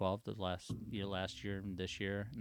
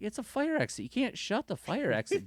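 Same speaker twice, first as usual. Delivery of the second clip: high, loud exasperated protest; 13 semitones higher, 4 dB louder than usual. Retold or imitated. Gate boss arguing fire exit with sheriff, high and exasperated. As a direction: insist on it.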